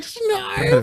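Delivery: high cartoon squeal; imitated yawn noise. Friend mocking Keenan's yawn, high cartoon squeak. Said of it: "Keenan's like".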